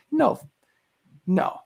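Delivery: usual speaking voice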